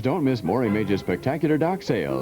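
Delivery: ordinary delivery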